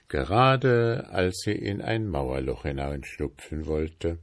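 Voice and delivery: ordinary delivery, nothing marked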